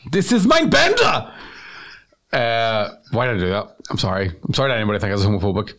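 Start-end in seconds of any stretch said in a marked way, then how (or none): 0.1-1.3 put-on high, slow German-accented announcement; mock German accent. Joker introducing a German boyfriend, hammed-up German accent. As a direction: proclaim it in a silly German accent.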